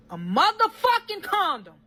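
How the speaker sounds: high, emphatic exclamation of outrage; outraged disbelief. Real, not acted. Storyteller revealing what she found to viewers, outraged. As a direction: hit it high, scandalised.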